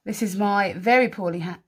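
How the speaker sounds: ordinary delivery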